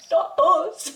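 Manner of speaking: high-pitched laughing denial; protesting innocence. Retold or imitated. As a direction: deny it with a high, amused yelp. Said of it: "And I'm like".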